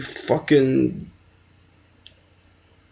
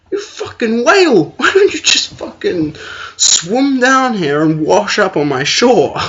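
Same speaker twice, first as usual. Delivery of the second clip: high-pitched comic tirade, laughing through it; indignant, pitched-up rant that gets louder; exasperated mock rant; 7 semitones higher, 4 dB louder than usual. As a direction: berate a flaky date in a squeaky outburst. Mock rant at flaky match.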